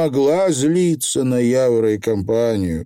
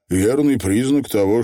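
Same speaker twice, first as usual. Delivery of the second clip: ordinary delivery, nothing marked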